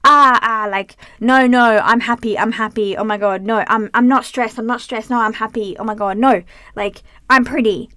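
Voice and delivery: loud panicky insistence, mocking herself; hammed-up panic, shouting down the bad thoughts; frantic mock self-talk. Vlogger mocking her own frantic positive thinking, loud. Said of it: "you sit there and you go".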